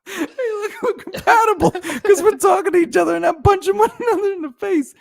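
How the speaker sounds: cracking up mid-sentence; laughing. Real, not acted. Laughing through his own joke.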